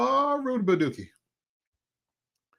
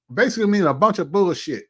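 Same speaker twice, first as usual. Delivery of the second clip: usual speaking voice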